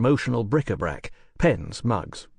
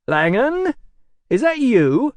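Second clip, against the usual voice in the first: American producer voice; 13 semitones higher, 6 dB louder, slower than usual. Acted. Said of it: "work a lot harder on that American accent".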